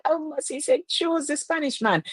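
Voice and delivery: high, thrilled repeat of the request; high-pitched excited echo. Real, not acted. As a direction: squeal it back in delight. Excitedly echoing a chat request.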